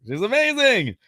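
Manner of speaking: over-the-top high gush; high-pitched gushing. Pretend. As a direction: squeal it in a playful high voice. Repeats praise in high voice.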